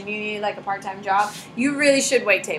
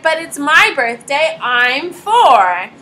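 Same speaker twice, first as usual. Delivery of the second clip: put-on higher, louder stubborn kid voice; whiny kid insisting; 4 semitones higher, 6 dB louder, much slower than usual. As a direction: dig in like a four-year-old.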